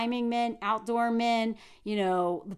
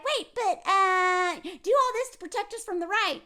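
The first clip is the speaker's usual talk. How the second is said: high, crying mock plea; mock whiny plea; 6 semitones higher than usual. Mocking the politician, a whiny crying plea. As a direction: whine it like a scared politician begging. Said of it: "And then you're like".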